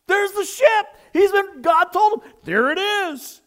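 high, loud excited cry; elated high-pitched outburst. Retold or imitated. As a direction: shout it out, thrilled at the sighting. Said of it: "He's so excited".

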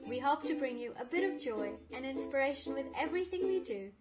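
bouncy scripted podcast intro; sing-song welcome over music; exaggerated cartoon intonation.

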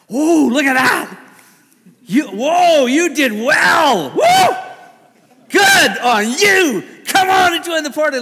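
high, loud, slow delighted cries; God as a giddy child, shouting with delight; giddy excitement. Pretend. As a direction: cheer like an overjoyed child at a party. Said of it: "see God as this kinda giddy child".

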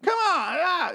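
mock squeaky high-pitched Daryl; old high Daryl. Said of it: "And he was all high".

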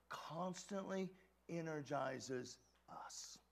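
slow, soft whisper. Acted. Preacher whispering scripture to the congregation. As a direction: breathe the words in awe.

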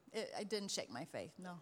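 usual speaking voice

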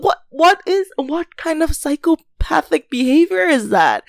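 high-pitched, stumbling disbelief; appalled disbelief. Real, not acted. Storyteller appalled by husband's behavior.

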